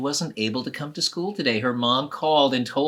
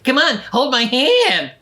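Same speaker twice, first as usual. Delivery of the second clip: put-on much higher, louder boyish plea; boy pleading; 9 semitones higher, 8 dB louder than usual. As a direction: coax her to take your hand. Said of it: "And Dill's like".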